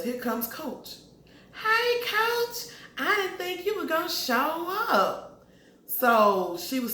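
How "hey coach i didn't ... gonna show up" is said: much higher put-on voice, faked cheerful surprise; surprised high greeting. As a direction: squeal a nervous hello at the coach. Greets Coach, surprised he came.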